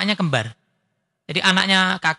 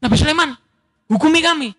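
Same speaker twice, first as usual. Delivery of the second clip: higher eager plea to the prophet; a woman pleading higher to the prophet; 6 semitones higher, faster than usual. Retold or imitated. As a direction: beg him eagerly for judgment. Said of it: "semangat ini".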